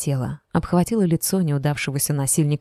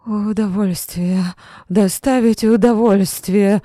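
slow, higher, louder slurred cry; drunken shout; 4 semitones higher, 5 dB louder, slower than usual. A narrator's reading. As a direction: shout it, still half drunk. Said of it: "закричал он".

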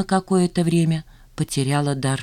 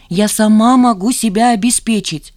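higher, louder protest; indignant retort; 6 semitones higher, 8 dB louder than usual. A narrator's reading. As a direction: push back indignantly. Жанна возражает отцу. (возмущённое возражение)

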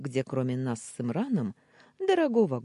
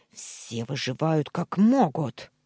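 slow, angry, building to loud; spiteful outburst; 5 dB louder, slower than usual. A narrator's reading. Uncle justifies his crimes, spitefully. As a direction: force it out with malice. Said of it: "со злостью выпалил он".

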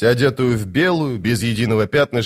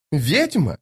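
higher pitch with a falling end; indignant repeat; 5 semitones higher than usual. Acted. Lady Envy advancing with burning eyes, indignant.

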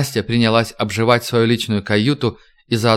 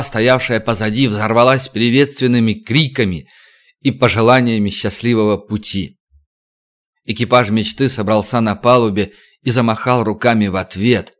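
usual speaking voice

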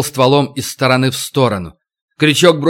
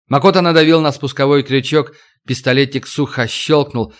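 plain reading voice

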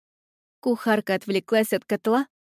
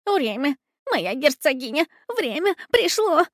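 rabbit shouting; 6 semitones higher than usual. A narrator's reading. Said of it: "кричал кролик".

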